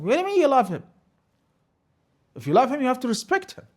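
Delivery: very high, loud rebuke; heated, high-pitched scolding; indignant challenge. Acted. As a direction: throw the claim back, high and loud. Challenging those who claim love.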